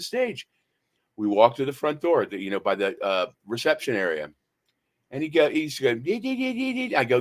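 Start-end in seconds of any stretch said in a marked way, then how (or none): 6.0-6.9 very high, thin-volume tantrum gibberish; squeaky mocking imitation, kept soft; mock childish screaming. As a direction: mimic someone's screaming as high nonsense. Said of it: "what he was screaming about".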